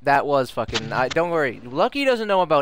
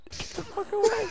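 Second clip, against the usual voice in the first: high squeal; 6 dB quieter than usual. Real, not acted. Shooing friend, laughing.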